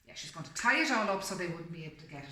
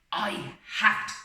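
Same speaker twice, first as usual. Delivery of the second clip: exclaimed; 3 semitones higher, 10 dB louder than usual. Acted. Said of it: "she exclaimed".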